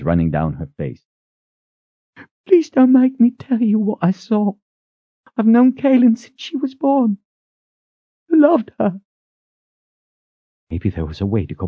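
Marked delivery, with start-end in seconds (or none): 2.5-9.0 grieving. A narrator's reading. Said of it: "she said in a broken voice".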